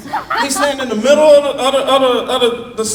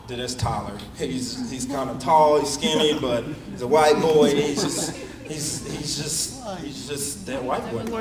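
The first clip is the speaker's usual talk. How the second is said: low quiet aside; 8 semitones lower, 8 dB quieter than usual. Retold or imitated. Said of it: "you're like".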